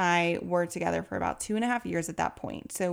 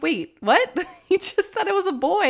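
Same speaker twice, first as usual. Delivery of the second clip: high, loud bewildered protest, laugh inside; baffled and half laughing; confused disbelief; 11 semitones higher, 6 dB louder than usual. Retold or imitated. Confused mother in delivery.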